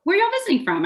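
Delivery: high, chirpy Southern question; friendly Southern "y'all", pitched high; turned-up Southern accent. Acted. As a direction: turn up the Southern accent for small talk. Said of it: "turning up my accent".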